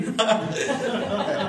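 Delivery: the preacher cracking up at the chicken story; high laugh. Real, not acted.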